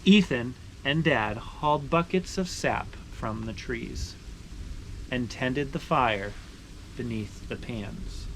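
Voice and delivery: slowing, softening read-aloud ending; story narration. Acted. Finishing the sugaring story for viewers, gentle storytelling close.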